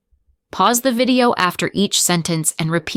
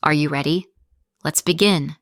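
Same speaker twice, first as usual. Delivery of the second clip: ordinary talk, nothing marked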